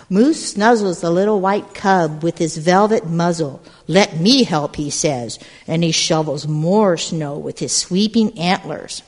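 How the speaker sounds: put-on lively read-aloud to a group of kids; storybook narration. A narrator's reading. Storyteller reading to gathered children, lively narration. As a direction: tell it like a picture book at storytime. Reads picture book aloud.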